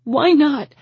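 high, loud demand; anguished cry. A narrator's reading. Woman hitting his chest, crying out. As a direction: cry it out, desperate. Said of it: "I cried".

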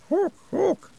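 high squawking cry; bird squawk. A narrator's reading. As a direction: squawk like a crow. Creature noise in pipes.

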